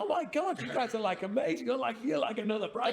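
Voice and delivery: high, over-the-top amazed voice; mock American gushing. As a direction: gush like a thrilled tourist. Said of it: "Everyone's just so excited".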